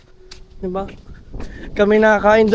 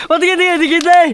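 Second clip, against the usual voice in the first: excited; 7 semitones higher, 3 dB louder, faster than usual. Real, not acted. Laughing, excited.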